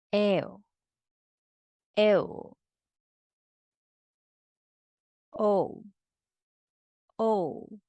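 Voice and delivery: "au", "Eu" glide into u, each crisp and short; model drill syllables. Shown as a demonstration. Teacher models Spanish diphthongs twice each for students. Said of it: "please repeat after me".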